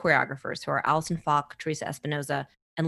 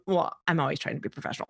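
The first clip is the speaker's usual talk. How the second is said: ordinary delivery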